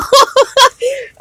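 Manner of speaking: high, breathy happy giggle. Real, not acted. Reader laughing off her own tears, high giggle. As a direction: giggle through the joy.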